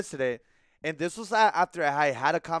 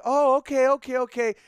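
higher, louder awkward agreeing; awkward high okay; 8 semitones higher, 5 dB louder than usual. Retold or imitated. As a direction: brush it off, pitched up. Own retold reaction, awkward.

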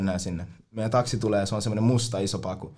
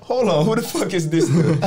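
laughing imitation of a rapper; 7 semitones higher, 4 dB louder than usual. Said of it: "ne oli ollut silleen".

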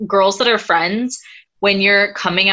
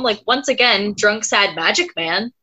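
ordinary talk

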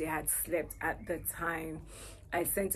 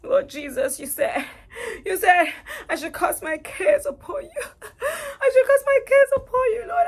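high, loud, crying prayer; tearful prayer; 8 dB louder than usual. Retold or imitated. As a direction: plead with God through sobs, building then easing. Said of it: "started saying".

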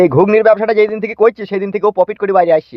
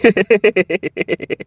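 lecherous chuckle. Acted. Lecherous father-in-law ogles mother.

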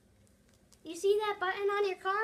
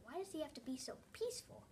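very soft fast grumble; muttered aside; 3 semitones lower, 13 dB quieter, faster than usual. Acted. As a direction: grumble it under your breath.

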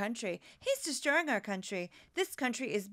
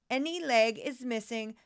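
ordinary talk

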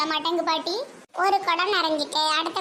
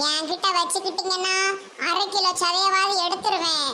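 ordinary talk

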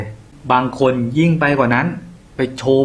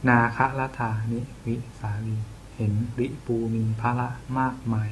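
reading under breath; 10 dB quieter than usual. Real, not acted. Tutor taking a quick look at a verse, reading under breath. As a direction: read the verse quietly to yourself.

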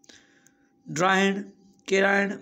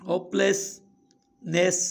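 هوپليسنيس very slowly, split by a long pause; syllable by syllable; much slower than usual. Shown as a demonstration. Teacher splitting a long word for learners, slowly in parts. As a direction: pull هوپليسنيس apart before "ness". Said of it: "ته ان لاءِ توهان هن جا سليبل ٺاهيو".

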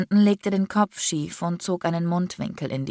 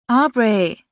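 a louder, admonishing call of Aubrey; warning; 7 semitones higher, 5 dB louder than usual. A narrator's reading. Vi to her friend Aubrey, warning call of Aubrey. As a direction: rein her in by name. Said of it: "mahnte ich sie".

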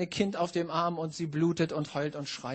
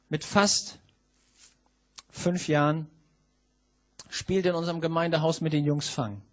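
ordinary delivery

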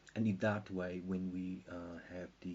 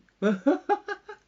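loud high laugh; 17 dB louder than usual. Real, not acted. Speaker amused at acting like this, loud high laugh. Laughs at own odd talk.

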